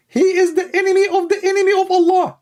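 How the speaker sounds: high, fast mock preacher; Ali Dawa. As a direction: squeak it out quickly like a parody preacher. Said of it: "Let us ask Ali Dawa why".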